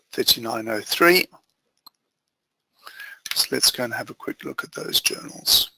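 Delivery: ordinary delivery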